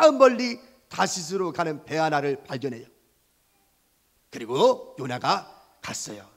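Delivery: ordinary talk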